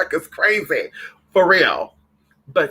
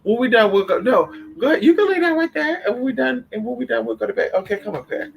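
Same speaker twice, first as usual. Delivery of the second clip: coaxing a pet; 6 semitones higher than usual. Real, not acted. Talking to her pet during stream.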